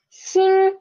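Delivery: "xin" held high and level, first tone; teacher's high level first tone. Shown as a demonstration. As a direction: sing "xin" high and flat. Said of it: "Read after me".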